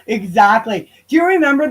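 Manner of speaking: ordinary talk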